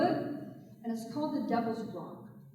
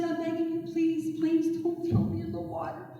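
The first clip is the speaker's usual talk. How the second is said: higher, pleading voice; begging turtle; 5 semitones higher than usual. A narrator's reading. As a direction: beg sweetly, overdone.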